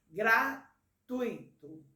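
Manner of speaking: "gratuito" bem lento, com tui numa só sílaba; pronúncia silabada. Shown as a demonstration. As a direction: destaque cada sílaba de "gratuito", sem separar u e i. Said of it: "preste atenção quando você pronuncia essa palavra". ("gratuito" pausado, com tui de uma vez)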